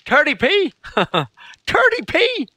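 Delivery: high, incredulous squeal of a question; tickled, hammed-up astonishment; mock disbelief. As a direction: laugh at it in high-pitched disbelief. Mock disbelief at southern accent.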